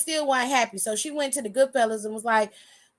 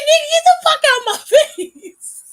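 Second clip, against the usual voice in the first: high yell breaking into laughter; laughing shout; 9 dB louder, faster than usual. Real, not acted. Teller acting Jimmy yelling at AJ, laughing through it. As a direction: shout it high and loud, cracking up mid-line.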